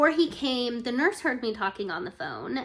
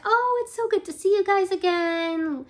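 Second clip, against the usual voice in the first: high, louder friendly greeting; very nice; 9 semitones higher, 6 dB louder than usual. Retold or imitated. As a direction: imitate a delighted doctor greeting familiar patients. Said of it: "she's very nice".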